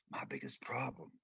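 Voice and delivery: hushed whisper building suspense; whispered reveal to the audience of the real problem; dramatic whisper. Acted.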